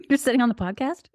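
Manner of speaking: amused, laughing through the words; laughing question. Real, not acted. Friend laughing at the podcast slip, amused high question. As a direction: laugh as you pick up her slip.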